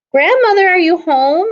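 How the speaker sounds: put-on a girl calling for her grandmother. Red calls from the door.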